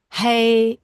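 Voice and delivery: normal talking voice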